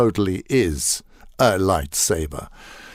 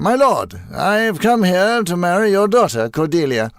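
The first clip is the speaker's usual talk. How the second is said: higher, louder grand theatrical declaration; hammy radio-drama suitor; 10 semitones higher, 6 dB louder than usual. Retold or imitated. Actor mocks radio drama, a suitor addressing a lord, grandly theatrical. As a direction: declaim it like an old-fashioned radio play hero. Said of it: "pretending you're going".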